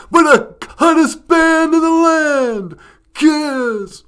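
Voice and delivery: very loud, high, drawn-out band introduction; goofy dog shouting a stage intro; hype announcer shout. Pretend. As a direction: belt it out like a rock concert announcer. Puppet imitates a concert announcer.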